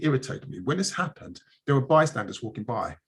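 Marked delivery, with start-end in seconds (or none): none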